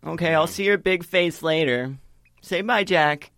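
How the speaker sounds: put-on high, loud cheery child voice; little girl voice. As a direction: chirp a goodbye like a small girl.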